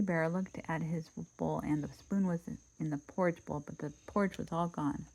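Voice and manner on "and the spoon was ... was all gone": ordinary delivery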